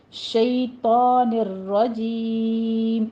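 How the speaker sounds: شیطان الرجیم with a heavy ta; شیطان الرجیم drawn out slowly; slow model of شیطان الرجیم; tajweed pronunciation. Shown as a demonstration. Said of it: "زبان کے کنارے سائیڈوں سے اٹھانے ہیں".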